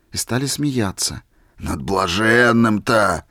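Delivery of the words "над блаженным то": slow, a bit louder, reproving; reproachful. A narrator's reading. Old man reproaching the drunks who mocked Misha. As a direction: shake your head at them. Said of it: "с укоризной сказал".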